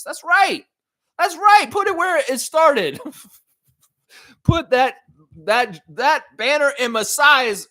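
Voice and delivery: hyped agreement with a viewer's comment; excited agreement. Real, not acted. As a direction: cheer it on.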